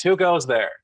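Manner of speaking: restrained mock shout of a sentry; guard's challenge shout. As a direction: call out a challenge into the distance. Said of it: "You hear another shout".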